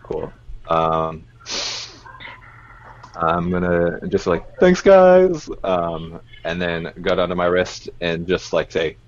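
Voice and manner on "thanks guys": high, loud call to friends; calling out thanks. Acted. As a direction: call out your thanks in a raised voice. Acted call to fellow party members.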